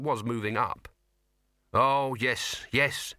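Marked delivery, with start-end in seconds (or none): 1.7-3.1 breathed. A narrator's reading. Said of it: "breathed Sol".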